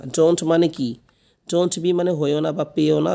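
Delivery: normal talking voice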